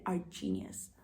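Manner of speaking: ordinary talk, nothing marked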